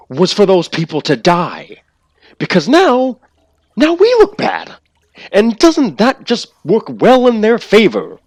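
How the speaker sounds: high, loud, raging accusation; furious villain rant. Acted. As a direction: spit it out with fury. Villain rant, angry speech.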